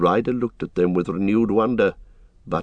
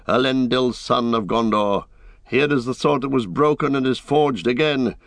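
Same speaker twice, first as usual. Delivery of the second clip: raised, ringing proclamation of titles; heroic ranger; 5 semitones higher than usual. Acted. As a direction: declare your lineage with stately pride. Aragorn proclaims his lineage.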